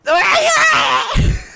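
very high, loud shriek of laughter. Real, not acted. Player after another miss, shrieking laugh. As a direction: burst into a screeching laugh.

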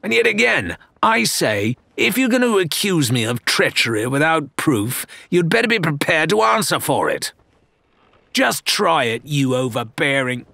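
snarling threat. Acted. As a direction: warn her off through a snarl. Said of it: "he snarled".

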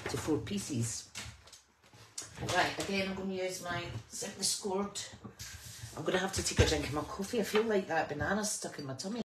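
normal talking voice

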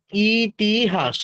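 very slow ইতিহাস, broken into three syllables; ইতিহাস pulled slowly apart by syllable. Shown as a demonstration. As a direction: draw ইতিহাস out as i, ti, has. Teacher demonstrates the syllable split.

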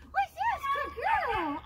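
high puppy talk. Real, not acted.

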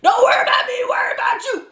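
shouting. Real, not acted. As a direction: yell it at your rival. Shouting at Monopoly opponent.

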